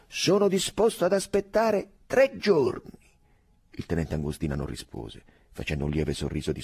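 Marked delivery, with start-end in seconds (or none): 0.1-3.0 put-on slow, loud and high, slightly drunk pleading; tipsy insistence. A narrator's reading. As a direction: coax a friend, a bit tipsy. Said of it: "ai confini dell'ubriachezza". (insistenza un po' brilla, lenta e forte)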